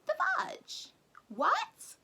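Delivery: very high, loud mock-outraged squeal; high-pitched fake-innocent outrage; squeaky shocked. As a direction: squeak it out in comic disbelief. Mock shocked high self-censor.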